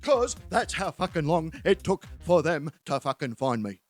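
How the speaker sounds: lightly voiced blokey friend, matter-of-fact; a hint of a dull-witted mate; Terry.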